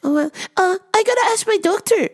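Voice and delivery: very high mock-accented hesitant customer; high-pitched comic caricature; Miss Swan. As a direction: imitate a customer stalling in a high voice. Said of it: "Miss Swan".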